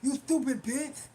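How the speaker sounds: slow, lumbering mock threats; mocking caller impression. Streamer imitates the caller wishing death on her, slow and mocking. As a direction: drag it out like the winded heckler. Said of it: "That's how he sound".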